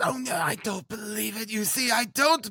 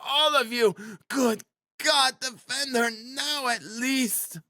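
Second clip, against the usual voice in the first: desperate, high, stretched-out appeal; despairing wail. A narrator's reading. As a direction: implore everyone in a wailing woman's voice. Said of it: "The wail of the poor".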